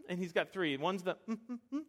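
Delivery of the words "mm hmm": a little primate-ish hum; primate-ish mm-hmm. Shown as a demonstration. Dad showing his son's first kind of yes. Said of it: "like that, uh, little, little primate-ish".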